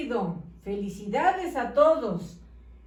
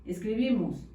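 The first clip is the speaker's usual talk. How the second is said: ordinary delivery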